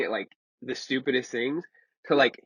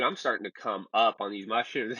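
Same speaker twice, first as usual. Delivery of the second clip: usual speaking voice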